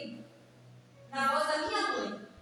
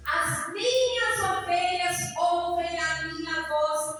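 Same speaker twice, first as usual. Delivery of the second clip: slow, sung-out scripture, louder and higher; preaching at full force; 4 semitones higher, 5 dB louder, much slower than usual. Acted. Pastor proclaiming John 10:27 to the congregation, slow sung preaching. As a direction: intone the verse like a rising chant.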